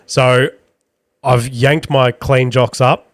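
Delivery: ordinary talk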